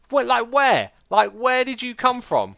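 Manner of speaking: high, loud incredulous questioning; incredulous demand. Real, not acted. Streamer questioning chat about missed finds, incredulous. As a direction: raise voice and pitch in disbelief. Reacting to being told of finds.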